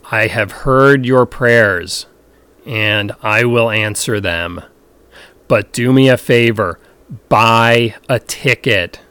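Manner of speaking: slow, loud, godlike boom. Acted. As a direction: boom it down from the heavens. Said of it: "A booming voice says".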